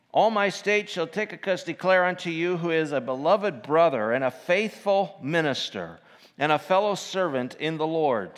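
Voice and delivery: lifted King James reading voice; scripture reading. Acted. Preacher reads Colossians four to the church, lifted reading.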